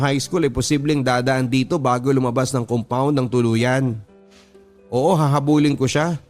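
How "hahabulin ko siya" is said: usual speaking voice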